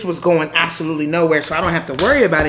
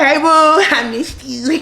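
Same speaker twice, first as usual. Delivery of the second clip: higher, laughing flirt; flirty, giggly; 7 semitones higher than usual. Retold or imitated. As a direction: tease him sweetly with a giggle. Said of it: "So I'm like".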